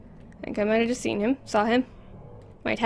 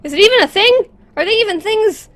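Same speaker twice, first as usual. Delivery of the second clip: high and loud, frightened questions; panicked; 8 semitones higher, 10 dB louder than usual. Real, not acted. Player spots strange things in the dark, panicked. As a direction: panic at what you see.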